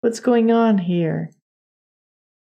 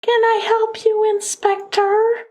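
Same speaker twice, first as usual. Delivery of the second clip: put-on Valley Girl; 14 semitones higher than usual. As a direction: put on a high Valley Girl, drawing out the last word. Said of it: "she's got the Valley Girl".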